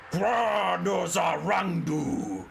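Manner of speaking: dark lord's roaring war cry; battle scream. Acted. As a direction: bellow it down from the mount. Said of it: "screams out the figure".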